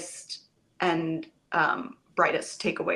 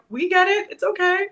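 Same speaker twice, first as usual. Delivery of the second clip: higher, gentle reassurance; high reassuring; 12 semitones higher than usual. Retold or imitated. Casting people reassuring.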